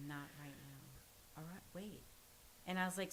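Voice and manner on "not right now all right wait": slow, much softer, distracted murmur; half-turned away, quietly waving off voices only he hears; murmuring to unseen voices. Son in psychosis answering hallucinated voices, slow soft murmur.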